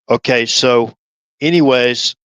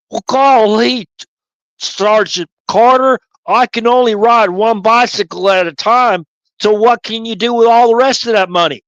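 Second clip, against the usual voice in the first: higher, wide-eyed country bumpkin, amazed; Gomer Pyle; 5 semitones higher than usual. Acted. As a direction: play a naive Southern private gushing at his sergeant. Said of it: "Gomer Pyle".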